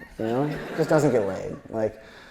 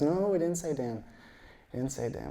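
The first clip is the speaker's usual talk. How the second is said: lightly imitated child, higher-pitched denial; little kid; 4 semitones higher, 6 dB quieter than usual. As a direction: protest like a small kid, voice lifted. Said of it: "this kid walks up to us".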